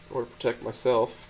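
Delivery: ordinary delivery, nothing marked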